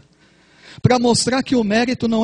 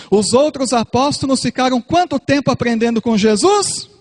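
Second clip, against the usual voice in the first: higher and louder, fiery sermon climax; preaching at full force; 8 semitones higher, 4 dB louder than usual. Acted. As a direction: preach it out with mounting fervour.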